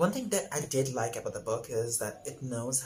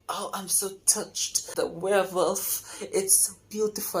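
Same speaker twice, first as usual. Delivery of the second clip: gushing Joe; 8 semitones higher than usual. Retold or imitated. Reviewer voicing Joe touched by the wolf gift, mock gushing. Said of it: "even Joe was like".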